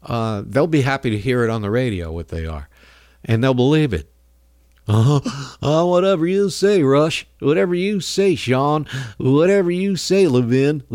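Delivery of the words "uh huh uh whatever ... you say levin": a higher, put-on gullible yes-man voice; mocking gullible fan. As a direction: parrot the radio host like a brainless follower. Mocking gullible talk-radio fans.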